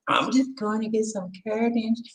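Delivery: soft, high mimicked voice; wife's shopping announcement. Wife casually tells husband about shopping, in a soft high mimicking voice. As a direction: put on a quiet, high, innocent voice. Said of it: "when they tell you".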